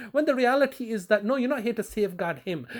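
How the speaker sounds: ordinary delivery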